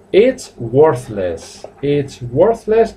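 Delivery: It's worthless said very slowly, every sound clear; teacher sounding out It's worthless for Spanish learners, slow and clear; slow, careful practice read. Shown as a demonstration. Slow for the class to repeat. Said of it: "T H es una Z en español".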